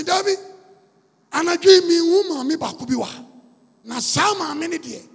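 loud, slow, emphatic preaching; full force preaching. Acted. Preacher tells church about the faithful woman, loud and slow. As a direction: hammer each word home to the church.

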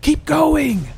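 high, loud drawn shout; urging yell. A narrator's reading. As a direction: yell to keep them moving. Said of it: "he yelled".

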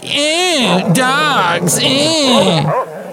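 high, nasal-free mock indifferent grunt; dismissive shrug. Mocking a listener who shrugs off dogs, high and dismissive. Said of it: "if you're like".

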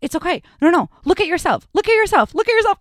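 repeated pressing urging; urgent coaxing. Retold or imitated. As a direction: get her to just look. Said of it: "they're like".

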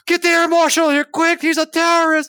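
high, loud alarmed yell; panicked shout. Retold or imitated. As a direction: shout for the air marshal. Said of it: "He's like".

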